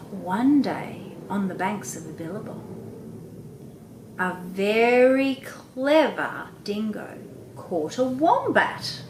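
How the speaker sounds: slow, lively read with a lift at the end; expressive storytelling. Shown as a demonstration. Parent reading the dingo story to a child, expressive and slow. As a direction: stretch it out and make them lean in. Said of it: "I've really changed my voice up".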